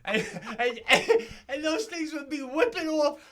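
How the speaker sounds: loud, high, talk through laughter; can't stop laughing as he tells it; real laughter mid-sentence; laughing while talking. Real, not acted.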